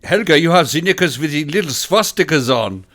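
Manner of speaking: hammed-up comic German accent; over-the-top mock German accent; fake German accent. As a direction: play a sitcom German officer with a silly accent. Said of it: "speaking in a German accent".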